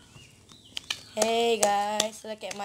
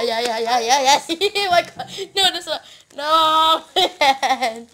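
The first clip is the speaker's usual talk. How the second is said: laughing through the words, louder; 5 semitones higher, 9 dB louder, slower than usual. Real, not acted. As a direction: talk while cracking up. Laughing fit over messy table.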